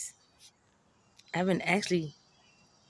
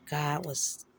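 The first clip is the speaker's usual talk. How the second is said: ordinary talk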